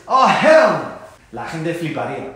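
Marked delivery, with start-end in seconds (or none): 0.1-1.0 emphatic refusal. Shown as a demonstration. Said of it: "Si tú dices".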